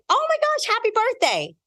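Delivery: very high squeal of delight; a lady at the elevator squealing happy birthday; gushing stranger. Retold or imitated. Stranger sees tiara.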